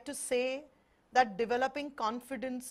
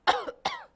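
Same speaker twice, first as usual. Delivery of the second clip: demo cough; 5 dB louder than usual. Shown as a demonstration. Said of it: "I have a bad cough".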